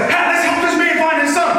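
high-pitched, urgent call to others; rallying call. Retold or imitated. Bystander rallying people to help a father, urgent shout. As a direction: imitate a bystander rounding up help.

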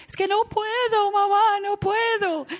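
put-on high child voice whining to mom; child whimpering it can't; whiny child. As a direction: plead like a helpless little kid. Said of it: "O cuando el niño dice".

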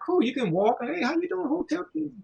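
a high, soft, easy mock greeting; relaxed mock hello, voiced high and soft; laid-back greeting. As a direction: play it mellow and friendly. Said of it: "you're like".

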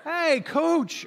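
high, drawn-out mock complaint; imitated aggrieved player. Football player runs to coach complaining, in high mock indignation. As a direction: play a baffled athlete tattling, pitch way up. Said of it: "imagine a football player who comes up".

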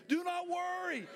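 loud high shout on not; shouted declaration. Shown as a demonstration. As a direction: shout it to the congregation. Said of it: "Say it with me again".

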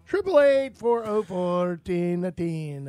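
drawn-out showman's phone number; hammed-up repeat. Host repeats number as showy patter.

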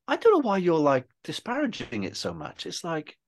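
high, animated imitation of a supportive mate; insistent encouragement. Friend defending the film to its self-critical maker, retold insistence. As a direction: retell the friend's protest, voice pitched well up.

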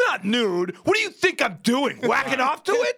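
indignant husband, pitch climbing high; mock defensive outburst. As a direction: protest like a husband under accusation. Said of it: "You defend yourself".

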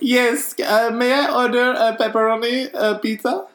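high, put-on voice of a hesitant pizza customer; put-on caller voice. Silly customer phoning the pizzeria to order, in a high put-on voice. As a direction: play the caller up high and a little unsure. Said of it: "We gotta go out of character".

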